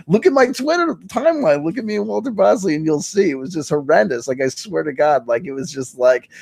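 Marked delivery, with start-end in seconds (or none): 0.1-3.3 excited. Real, not acted. High excited plea.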